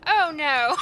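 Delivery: loud yelp of alarm breaking into a shrieking laugh; shocked shout. Real, not acted. Player sees the wife about to beat up the mistress, shout then laugh. Player reacts to fight starting.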